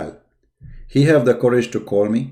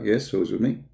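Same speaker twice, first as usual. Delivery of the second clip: ordinary delivery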